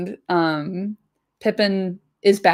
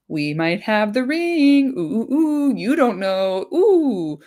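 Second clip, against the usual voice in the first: teasing hobbit taunt; 4 semitones higher, slower than usual. Retold or imitated. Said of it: "by being like".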